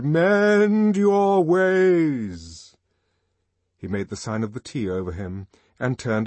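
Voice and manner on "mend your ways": very slow, much louder, higher drawn-out doom; sepulchral, booming. A narrator's reading. Songster's last warning to Bernard, booming sepulchral. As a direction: drag it out like a final judgement. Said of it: "His voice became sepulchral".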